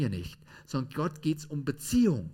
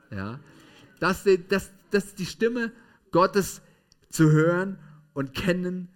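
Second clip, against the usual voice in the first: ordinary talk, nothing marked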